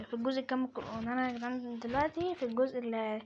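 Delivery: ordinary talk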